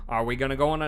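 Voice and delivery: southern accent attempt. Trying a Southern accent on a game character. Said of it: "gotta get into the mood for the southern accent".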